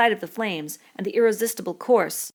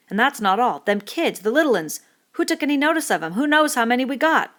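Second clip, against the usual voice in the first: Piggy's indignant lecture, louder and higher; a fussy boy scolding the whole group, voice raised; Piggy's tirade; 7 semitones higher, 8 dB louder than usual. Acted. Piggy scolding the laughing boys, loud and indignant.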